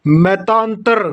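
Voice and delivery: मतांतर said slowly with every syllable clear; slow pronunciation demo. Shown as a demonstration. Teacher dictating मतांतर slowly for students. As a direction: draw out मतांतर so the class hears each sound. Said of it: "यानी कि अशुद्ध और शुद्ध".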